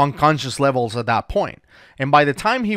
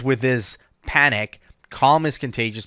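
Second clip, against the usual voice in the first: ordinary delivery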